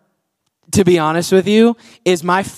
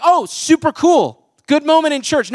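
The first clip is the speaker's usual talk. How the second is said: mock casual approval; 6 semitones higher than usual. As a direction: play a shallow "how cool" reaction. Mocking a casual reaction to verse.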